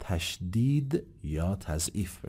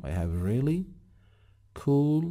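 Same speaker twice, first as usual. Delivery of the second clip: "really" pushed, "cool" rising higher; stress on really; 5 semitones higher, slower than usual. Shown as a demonstration. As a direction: lean on "really", lift "cool". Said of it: "تأکید بکنم". (تأکید روی really)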